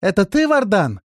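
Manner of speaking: high, loud call from below; stifled voice from below. A narrator's reading. Woman in the well asking for Vardan, stifled call. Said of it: "раздался снизу придушенный голос".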